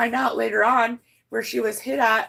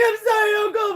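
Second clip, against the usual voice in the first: high-pitched tearful apology, reenacted; retold bawling; 10 semitones higher, 3 dB louder than usual. Niece apologizes to her uncle at the grave, imitated bawling. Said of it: "We were all fucking bawling".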